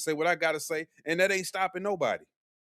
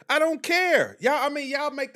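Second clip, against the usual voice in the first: defiant outburst; 9 semitones higher, 4 dB louder than usual. Real, not acted. Denies being hurt by viewers.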